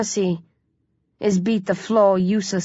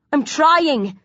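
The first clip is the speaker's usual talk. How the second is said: tense retort; 7 semitones higher than usual. A narrator's reading. Hero tense, answering begging boy.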